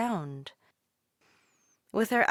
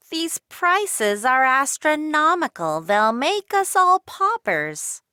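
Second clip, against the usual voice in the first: much higher and louder shopper voice; a shopper moaning loudly about prices; grumbling shopper; 8 semitones higher, 10 dB louder than usual. A narrator's reading. Shopper at the market complaining, much higher and louder. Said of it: "listen to shoppers".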